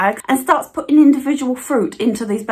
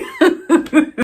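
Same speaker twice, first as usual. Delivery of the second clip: real laugh; 4 semitones higher than usual. Real, not acted. Laughs at own jibe.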